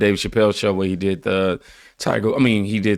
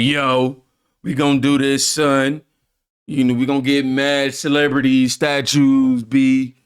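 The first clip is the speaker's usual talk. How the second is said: a mock street accent, a little louder and higher; mock street slang voice; 4 semitones higher, 3 dB louder, slower than usual. Host mocks a president faking a street accent. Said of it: "He ain't up there faking his accent".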